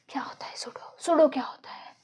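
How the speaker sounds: unvoiced whisper, searching memory; whispering to herself. Real, not acted. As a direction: whisper it to yourself while thinking. Thinking aloud to herself.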